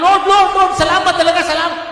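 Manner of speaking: high, loud shouted thanks to the Lord; preacher acting a believer shouting thanks; crying out thanks. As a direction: cry out to God in overflowing gratitude. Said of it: "talagang umiiyak siya sa Panginoon".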